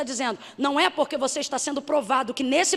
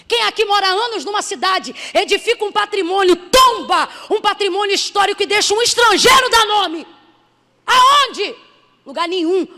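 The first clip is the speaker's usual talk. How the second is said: rising challenge; 6 semitones higher, 8 dB louder than usual. Real, not acted. Preacher challenging the congregation.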